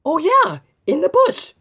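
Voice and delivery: excited find. Acted. Host praising viewers finding Boris, excited. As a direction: cheer the hidden bird spotted.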